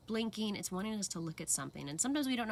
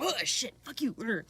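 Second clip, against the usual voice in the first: higher, louder mock snap; an imitated burst of temper, higher and louder; mock outburst; 5 semitones higher, 6 dB louder than usual. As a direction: fire it off like a sudden flash of temper. Acted sudden angry outburst.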